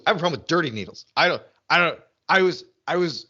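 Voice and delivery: normal talking voice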